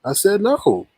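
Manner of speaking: fed up with being asked; insistent. Real, not acted. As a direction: restate it firmly, pitch jumping up. Repeats answer when pressed to answer.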